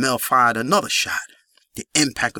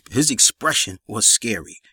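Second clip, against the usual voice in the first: plain reading voice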